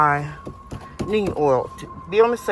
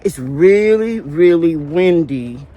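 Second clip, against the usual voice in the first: raised voice over the wind; louder, pushing against gusts; wind in the face; 8 dB louder, slower than usual. Real, not acted. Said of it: "It's really windy out here".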